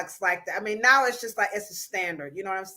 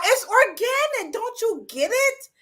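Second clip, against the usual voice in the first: high-pitched, exasperated insistence; mock indignation; 10 semitones higher, 5 dB louder than usual. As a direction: protest as if it were obvious. Comic defence of castor oil.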